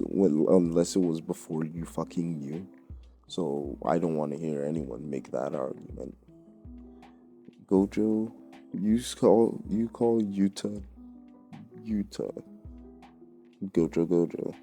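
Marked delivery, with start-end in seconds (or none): none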